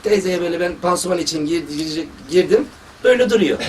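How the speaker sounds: ordinary talk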